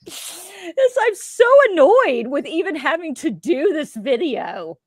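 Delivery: high, put-on voice of her old self; mock younger self. Host mocks her old video self, high put-on voice. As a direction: parody her own past annoyance.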